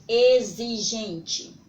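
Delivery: put-on teacher loudly sounding out "exigente" for children; sounding out the word. Shown as a demonstration. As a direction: stretch "exigente" out loud to show its Z sound. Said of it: "através da pronúncia da palavra".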